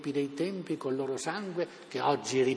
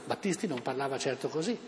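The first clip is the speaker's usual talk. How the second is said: normal talking voice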